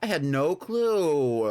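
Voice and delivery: slow, drawn-out deadpan sarcasm; sarcastic, dragging each word out. Shown as a demonstration. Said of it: "I'm being sarcastic".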